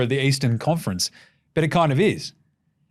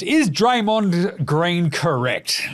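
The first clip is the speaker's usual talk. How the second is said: ordinary talk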